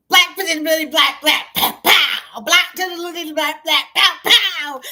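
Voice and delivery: loud, high comic sound effects; mock punch noises. Commentator mimics friend's two punches, loud mock punch noises. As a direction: belt out the hits like a cartoon fight.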